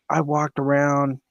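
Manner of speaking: normal talking voice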